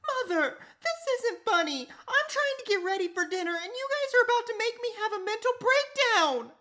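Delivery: high, loud frazzled scolding; a mom at the end of her rope; exasperated mom. Acted.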